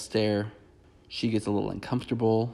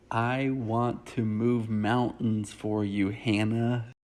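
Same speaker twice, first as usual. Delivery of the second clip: very slow, drawn-out words; mock intense declaration; much slower than usual. He declares himself to the Bachelorette, mock intense and slowly drawn out. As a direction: stretch each word out in hammed-up earnestness.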